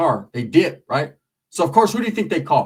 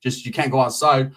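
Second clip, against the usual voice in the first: normal talking voice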